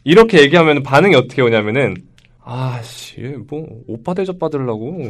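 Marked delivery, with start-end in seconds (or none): 2.4-5.1 작고 느리게 투덜대는 빈정거림; 못마땅해서 혼잣말처럼 비꼬듯; 비아냥대는 말투. Retold or imitated. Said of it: "아, 연기가 많이 느셨네요". (빠른 년생 소개를 듣고 빈정대는 사람 흉내, 작고 느린 투덜거림)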